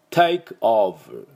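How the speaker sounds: "テイクオーバー" as English "take over", buzzed V; crisp T and long vowels in "テイクオーバー"; clean English pronunciation. Shown as a demonstration. Teacher completing the lesson's word, "テイクオーバー" in clean English pronunciation. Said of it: "テイクオーバーの綺麗な発音完成です".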